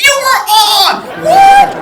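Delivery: imitating a friend's shocked squeal; surprised shriek. Friend at the movie theater shrieking that she was on screen.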